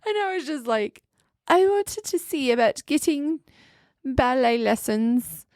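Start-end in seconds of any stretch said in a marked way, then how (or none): 1.5-5.5 higher mock-British request; imitation British accent, pitched up; put-on British accent. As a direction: pretend to be British. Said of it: "I just kept talking like that".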